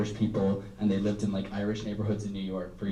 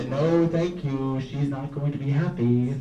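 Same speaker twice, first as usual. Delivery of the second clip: no, thank you, a touch louder and sharper; mock horror; 3 semitones higher, 4 dB louder than usual. Imagining granny watching, mock prudish refusal.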